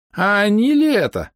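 put-on high, slow doubting old voice; suspicious old man. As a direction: squint and doubt them slowly.

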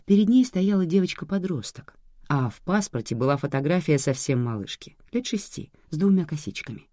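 plain reading voice

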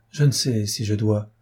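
usual speaking voice

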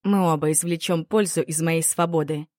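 ordinary delivery

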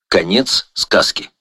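usual speaking voice

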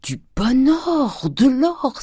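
high, loud, drawn-out glee; exultant exclamation. A narrator's reading. Grandet finding gold in the case, exultant exclamation. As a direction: crow over the treasure, stretching the words. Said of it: "s'écria-t-il".

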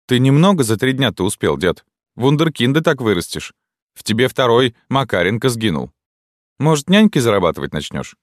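high, sarcastic teasing; smirking mockery. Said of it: "Лиза ухмыльнулась".

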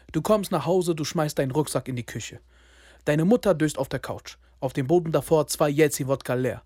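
usual speaking voice

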